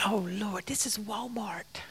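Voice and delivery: hushed, breathless whisper to the Lord; whispered plea. Retold or imitated. Speaker whispering to the Lord in a busy checkout, startled whisper. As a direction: whisper it like a secret prayer in the store.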